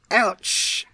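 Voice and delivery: high sharp ouch; pained yelp. A narrator's reading. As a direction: yelp from stubbed toe again. Kicks sore toe.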